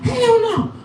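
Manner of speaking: high, whimpering hell no; squeaky frightened refusal; panicked refusal. Retold or imitated. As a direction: beg her off in comic panic.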